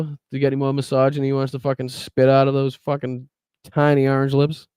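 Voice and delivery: ordinary talk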